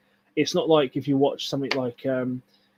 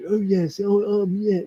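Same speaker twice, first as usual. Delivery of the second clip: raised, stilted mock British accent; Keanu Reeves' bad British accent; 6 semitones higher, slower than usual. Mocking an actor's failed British accent in a film review, hammed-up fake British accent. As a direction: imitate a clumsy fake British accent, a bit higher. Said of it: "it sounds like Keanu Reeves, like, trying to do British".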